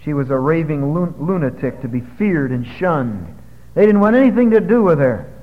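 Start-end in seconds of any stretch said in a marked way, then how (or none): none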